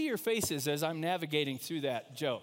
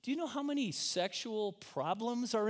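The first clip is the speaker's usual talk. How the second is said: self quote; 9 semitones higher than usual. Retold or imitated. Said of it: "I go".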